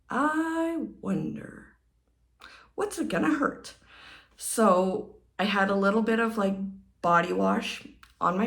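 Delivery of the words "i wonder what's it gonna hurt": wondering inner thought. Retold or imitated. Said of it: "I was like".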